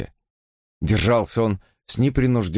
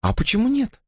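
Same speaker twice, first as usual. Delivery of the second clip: high, heated protest; heated objection. Acted. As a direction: object hotly and fast. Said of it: "горячо возразила она".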